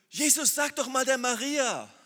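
high, loud, slow whiny plea; complaining Martha. Acted. Martha whining to Jesus to tell Maria off. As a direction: whine at Jesus like the fretting sister. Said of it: "die war ja so bekümmert".

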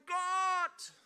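lauter, hoher Ruf nach Gott; Schrei nach Gott. Shown as a demonstration. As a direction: ruf Gott laut und verzweifelt an, als wäre er weit weg. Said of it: "Du musst nicht schreien". (vorgeführter lauter Schrei)

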